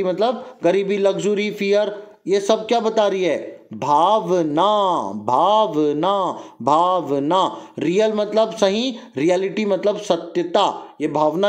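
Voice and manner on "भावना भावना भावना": drawn-out teaching. Shown as a demonstration. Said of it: "teacher drawing out the key word".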